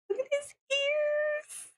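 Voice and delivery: high, slow delighted squeal; excited squeal. Real, not acted. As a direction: gush over a cute detail. Excited about the sprite's ears.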